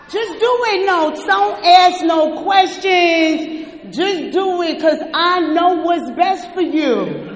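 loud, commanding, no arguing; a mock tough-love shout; bossy insistence. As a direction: bark the orders like a drill sergeant.

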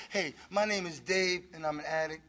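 soft voice of a fellow addict; humble addict. Retold or imitated. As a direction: confess it quietly like at a meeting.

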